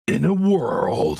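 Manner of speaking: slow dramatic trailer opener; movie trailer announcer, drawn out. Pretend. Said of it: "trailer voice".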